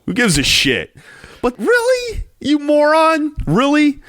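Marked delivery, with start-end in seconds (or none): 1.4-4.0 exasperated. Real, not acted. Ranting about a player's bong video.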